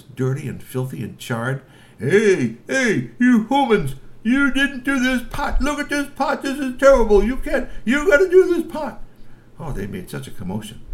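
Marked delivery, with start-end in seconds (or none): 2.0-9.0 very high, loud, indignant troll voice; squeaky troll complaint. Acted. Trolls scolding the humans about the dirty pot, shrill and loud. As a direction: shriek it like an outraged little troll.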